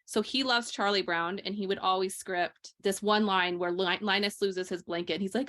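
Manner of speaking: usual speaking voice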